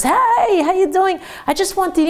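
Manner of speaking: high, bright-eyed hello to a classmate; student cheerfully greeting a classmate; cheery greeting. Retold or imitated. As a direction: greet him like an old friend, way up high.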